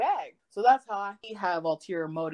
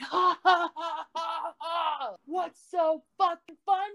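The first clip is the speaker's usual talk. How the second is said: top of my lungs; 10 semitones higher, slower than usual. Retold or imitated. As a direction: cackle at her full volume. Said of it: "I do it at the top of my lungs".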